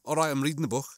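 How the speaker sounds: lightly demonstrated Scouse accent; casual Scouse chat. Shown as a demonstration. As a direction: say it as a Liverpudlian would. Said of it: "you get some Scouse to go".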